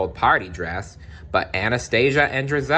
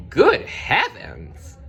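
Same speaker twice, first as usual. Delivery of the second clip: much higher, slow dismayed exclamation; shocked godmother; 9 semitones higher, much slower than usual. Acted. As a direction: gasp at the ruined dress. Fairy godmother sees the rags.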